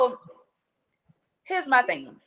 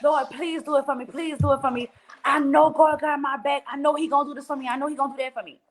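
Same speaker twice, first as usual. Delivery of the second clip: higher-pitched imitation of eager prayer; mock fervent prayer, raised pitch; gung-ho churchgoer praying; 3 semitones higher than usual. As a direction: plead like a keen believer, lightly acted. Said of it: "They are so gung-ho".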